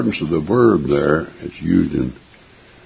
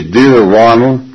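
overfamiliar letter greeting; 4 semitones higher, 6 dB louder, slower than usual. Retold or imitated. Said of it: "and say".

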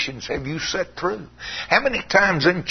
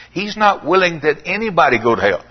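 ordinary talk

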